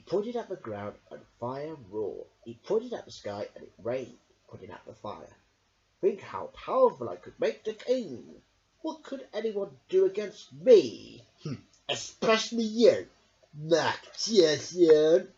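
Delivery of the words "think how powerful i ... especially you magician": louder, slow drawn-out taunt; gloating sorcerer. A narrator's reading. Sondrak calling to Merrick, loud and gloating. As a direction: threaten him with a sneer. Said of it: "he called to Merrick".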